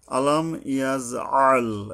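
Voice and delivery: slow "আলাম ইয়াজ আল" with a pharyngeal "আল"; deliberate "আলাম ইয়াজ আল", ain from the throat; throat-squeezed ain. Shown as a demonstration. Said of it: "আইন গলা চেপে আদায় করতে হবে".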